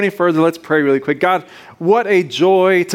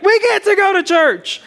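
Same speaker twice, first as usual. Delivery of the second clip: excited; 12 semitones higher than usual. Real, not acted. Excited opener to congregation.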